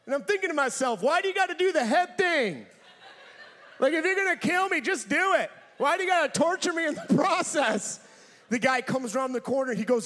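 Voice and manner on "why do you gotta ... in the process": loud, higher comic indignation; worked-up retold complaint; exasperated protest. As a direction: protest loudly at your tormentor.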